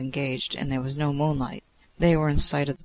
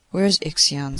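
ordinary delivery, nothing marked

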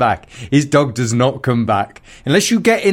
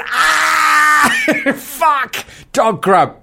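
imitating a panicked owner; 11 semitones higher, much slower than usual. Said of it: "Someone goes".

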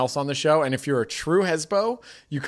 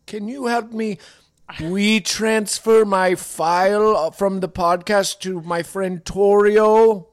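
put-on slow, loud old-timer confused by technology; helpless old man; 6 semitones higher, 4 dB louder, slower than usual. An old man asking for help with his phone, slow and befuddled. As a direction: labor through each word like a baffled elder. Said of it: "I'm gonna be holding my phone like".

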